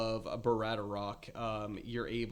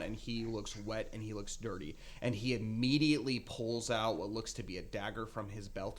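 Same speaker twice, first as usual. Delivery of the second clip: ordinary delivery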